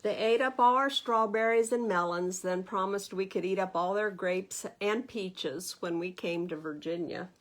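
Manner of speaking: put-on expressive read-aloud to children; lively storybook narration for little listeners; story reading. A narrator's reading. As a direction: read it like a bedtime picture book. Reads picture book aloud.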